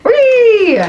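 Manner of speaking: high, drawn-out whee; gleeful squeal. Real, not acted. Celebrating more drink left over.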